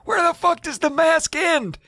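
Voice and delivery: high, frantic, near-crying voice; panicked old man. Retold or imitated. Steve can't find the mask's seam in the mirror, frantic high voice. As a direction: search desperately for the edge. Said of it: "He's like".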